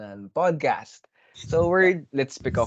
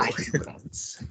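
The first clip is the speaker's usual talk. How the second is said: whispered drawl; 3 semitones lower, 7 dB quieter, much slower than usual. Pretend. Mock-dramatic quote in-joke.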